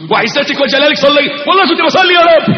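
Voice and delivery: fast, rising protest; panicked pleading. Acted. Servant swearing to God he prayed, panicked. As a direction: plead your innocence in a rush.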